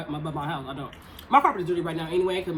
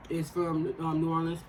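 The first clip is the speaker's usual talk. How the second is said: ordinary talk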